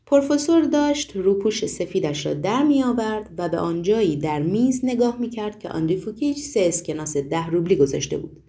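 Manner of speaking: ordinary delivery, nothing marked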